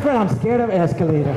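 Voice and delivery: a hint of a frightened big guy admitting fear; mild imitation of a scared friend; impersonating Dave scared. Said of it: "I'm gonna do the impersonation of him".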